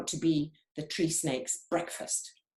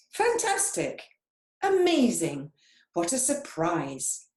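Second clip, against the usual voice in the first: delighted surprise; 3 dB louder than usual. Acted. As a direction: gasp with joy at yourself. Chameleon sees his colours.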